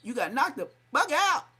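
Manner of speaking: mock yell. Homies yelling at Crip Mac after the knockout, high mock yell. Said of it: "it was like".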